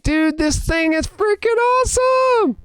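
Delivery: high, slow, drawn-out excited yell; thrilled shout. Retold or imitated. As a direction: stretch it into a squeal of joy. Re-enacts his thrilled reaction.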